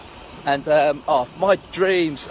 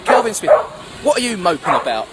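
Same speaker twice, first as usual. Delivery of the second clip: raised, louder mock scolding; teacher calling out; 4 semitones higher, 4 dB louder than usual. Miss calling out a moping pupil at registration, mock scolding. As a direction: call the pupil to attention, loud and brisk in manner.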